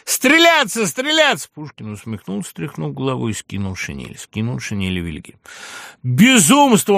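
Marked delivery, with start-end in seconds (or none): 0.0-1.5 high, drawn-out yell; shrieked demand for a duel; furious cry. A narrator's reading. Kyukhlya repeats his shouted challenge. 6.0-7.0 loud, high exclamation; outraged yell at madness; appalled shout. A narrator's reading. Friend cries out at the duel.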